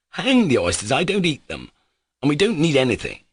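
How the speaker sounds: mild annoyed rebuff; almost angrily. A narrator's reading. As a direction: wave the oysters away crossly. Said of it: "almost angrily".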